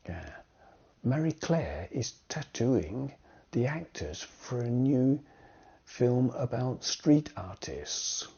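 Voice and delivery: ordinary delivery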